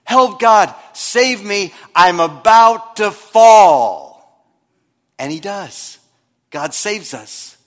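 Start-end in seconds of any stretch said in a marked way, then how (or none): 0.0-4.0 loud cry for help, stretched out at the end; desperate plea. Pretend. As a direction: call out to God as if about to fall. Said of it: "almost like this".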